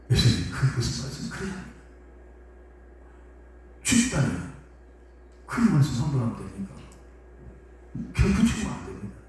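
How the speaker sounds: pleading Peter. Acted. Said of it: "이런 식으로 예수님을".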